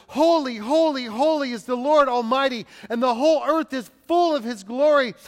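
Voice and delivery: loud, ringing acted exclamation; calling out. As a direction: call it out like angels in praise. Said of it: "they were calling to one another".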